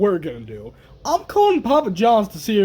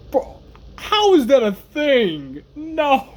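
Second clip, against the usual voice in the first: incredulous; 7 semitones higher, slower than usual. Real, not acted. Disbelief at chicken poppers.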